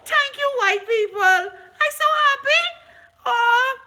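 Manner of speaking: high, loud fake tearful gratitude, slow; mock sobbing joy. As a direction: wail the thanks in a hammed-up weepy praise.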